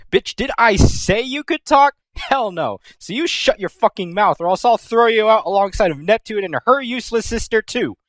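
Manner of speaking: high mock-furious rant; angry Noir. Noir snapping at Uni, high mock-angry rant. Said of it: "Noir is all like".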